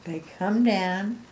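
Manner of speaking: usual speaking voice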